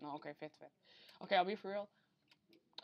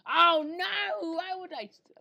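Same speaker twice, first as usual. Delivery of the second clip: much higher and louder cry of dismay; alarmed shout; 12 semitones higher, 15 dB louder, much slower than usual. Real, not acted. Gamer missing a jump on stream, alarmed shout. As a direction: yelp as the jump goes wrong. Sudden fail in the game.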